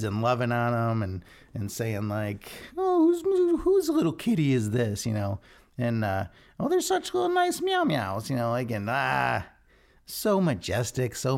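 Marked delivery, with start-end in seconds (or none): 2.8-5.0 very high cooing pet voice; squeaky baby talk to a kitty. Pretend. 6.6-8.3 very high cooing pet voice; squeaky baby talk to a kitty. Pretend.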